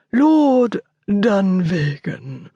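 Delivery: butler announcing, very slow and drawn out; formal announcement. Acted. The butler presents the lord at the study door, slow formal announcement. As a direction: announce the name slowly to the room. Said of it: "he announced".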